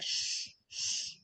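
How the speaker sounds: a drawn hissing "sh"; sh sound. Shown as a demonstration. Teacher showing students how X sounds. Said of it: "it sounds like".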